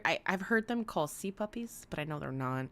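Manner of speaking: usual speaking voice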